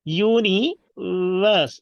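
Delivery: UNIVERSE drawn out slowly in parts; syllable-by-syllable model. Shown as a demonstration. Teacher models universe for repeating, slow and segmented.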